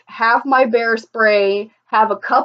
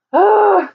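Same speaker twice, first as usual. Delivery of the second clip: sudden cry; 11 semitones higher, 4 dB louder than usual. Real, not acted. Sudden outburst mid-sentence.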